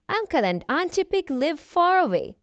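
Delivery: usual speaking voice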